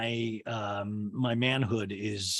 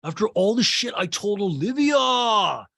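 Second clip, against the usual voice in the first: mock indignant; 5 semitones higher, 5 dB louder than usual. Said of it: "he's like".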